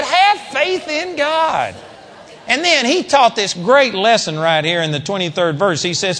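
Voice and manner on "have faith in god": shouted command. Retold or imitated. Replaying Jesus' rebuke.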